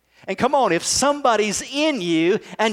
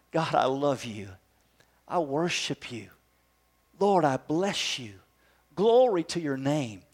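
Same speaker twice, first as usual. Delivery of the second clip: soft worship; 5 semitones lower, 5 dB quieter than usual. Shown as a demonstration. Modelled worship words.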